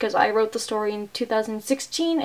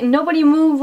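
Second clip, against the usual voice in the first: louder, higher hostage threat in pretence; mock hostage-taker; 5 semitones higher, 5 dB louder than usual. Woman pretends to take nurse hostage, mock threatening. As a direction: order everyone to freeze, play-acting. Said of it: "Hai pretends to take her, like, hostage".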